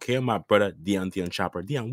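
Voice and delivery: normal talking voice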